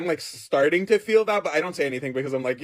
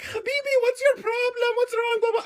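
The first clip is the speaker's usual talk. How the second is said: very high-pitched nurse impression; put-on high Russian nurse voice. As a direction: squeal it like a fussing nurse. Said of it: "I had a Russian nurse".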